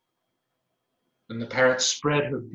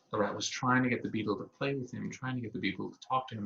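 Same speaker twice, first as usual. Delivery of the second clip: ordinary delivery, nothing marked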